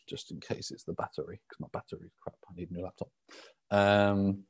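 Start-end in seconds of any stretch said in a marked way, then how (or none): 0.1-3.1 muttered aside. Real, not acted. Muttering to himself about laptop.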